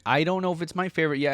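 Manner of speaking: normal talking voice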